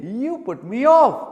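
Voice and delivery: slow, loud, high-pitched complaint; acted annoyance. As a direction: draw it out loudly, as a mock reproach. Repeats the acted complaint louder.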